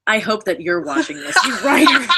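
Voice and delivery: half-laughing mock call-out; laughing taunt. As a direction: tease the writer through laughter. Mock taunt at hated writer.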